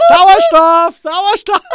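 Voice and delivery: very high, loud squeal after laughing; breathless cry for air. Real, not acted. Calling for oxygen after a laughing fit, high loud squeal.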